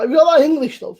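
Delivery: mock Geordie accent. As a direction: play up the Geordie lilt, teasing. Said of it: "Diana's like".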